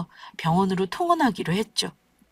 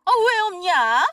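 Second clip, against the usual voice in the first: high-pitched, indignant boasting about her son; exasperated mother-in-law; 11 semitones higher than usual. Acted. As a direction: lament that he deserves better. (아들이 아까워 분통 터진 시어머니)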